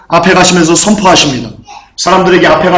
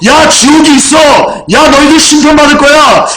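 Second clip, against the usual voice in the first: crying out; 9 semitones higher than usual. Retold or imitated. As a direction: call out the warning as you walk, full voice. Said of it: "라고 외치면서요".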